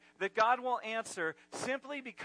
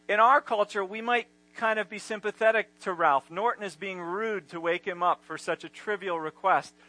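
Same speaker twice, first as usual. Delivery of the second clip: ordinary talk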